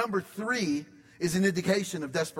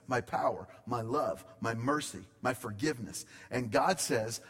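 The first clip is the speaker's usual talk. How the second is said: normal talking voice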